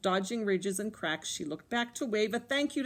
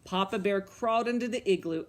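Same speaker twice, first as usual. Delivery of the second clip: usual speaking voice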